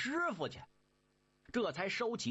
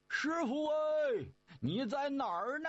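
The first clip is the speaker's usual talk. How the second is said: calling out; 9 semitones higher than usual. Acted. Brothers calling for master in forest, high calls. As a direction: shout for the lost master. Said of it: "这三位就喊开了".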